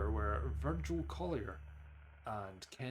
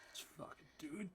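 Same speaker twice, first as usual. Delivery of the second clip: hushed half-whisper; very soft, under the breath; 4 semitones higher, 11 dB quieter than usual. Real, not acted. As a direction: whisper it to yourself. Psyching up, barely voiced.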